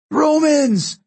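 very high, desperate shout. A narrator's reading. Jason appeals to the attacking Roman crowd, shouting. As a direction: shout it across the forum. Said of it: "she heard Jason shouting".

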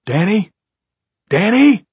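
loud, high desperate shout; shouting a name. A narrator's reading. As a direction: yell it up the stairs. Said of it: "raised his voice again".

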